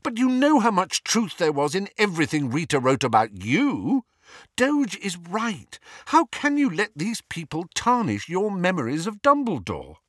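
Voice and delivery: high-pitched, urgent protest; impassioned challenge. A narrator's reading. Hermione defending Dumbledore's memory to Harry, impassioned. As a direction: argue fervently, voice pitched right up.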